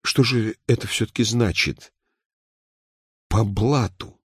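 mildly bashful asking, with a pause; shy question. A narrator's reading. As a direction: ask a little timidly about a new word. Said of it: "застенчиво спросил". (немного застенчивый вопрос)

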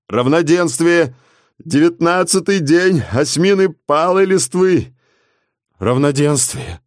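slow, high hoarse cry; cracked proclamation. Acted. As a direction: call it out, voice cracking. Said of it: "глухим надтреснутым голосом выкрикивал". (глухой надтреснутый выкрик)

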